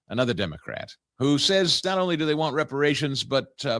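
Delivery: ordinary delivery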